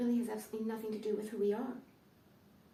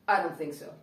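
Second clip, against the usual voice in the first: 1 dismissive; 4 semitones lower, 5 dB louder than usual. Retold or imitated.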